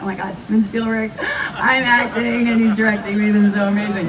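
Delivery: fast, breathless mock gush of excitement; starstruck inner gush. Actress gushing to herself about Spielberg directing her, fast and thrilled. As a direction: rattle it off like a starstruck fan. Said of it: "I'm like".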